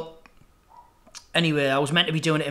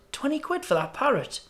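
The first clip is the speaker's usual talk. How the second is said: higher-pitched disbelieving question; incredulous customer; 9 semitones higher, 4 dB quieter than usual. Acted. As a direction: query the price, pitch lifting in disbelief. Joke customer reacts to the price.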